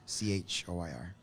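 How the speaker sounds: each letter said separately; spelled out. Shown as a demonstration. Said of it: "In choir about what?".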